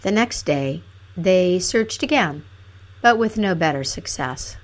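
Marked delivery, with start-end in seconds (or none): none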